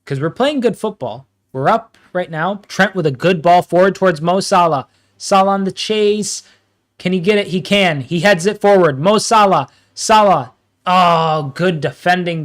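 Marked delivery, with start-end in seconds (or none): 2.7-12.4 louder, higher play-by-play; excited commentary. Real, not acted. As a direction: call the chase live. Live play-by-play build.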